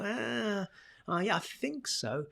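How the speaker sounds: hesitant, rising, drawn-out "ah, ah, yeah"; wavering uncertain filler; unsure hesitation. Shown as a demonstration. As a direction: sound like you doubt yourself. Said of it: "straight away you're stepping off that ten down to about a six of confidence".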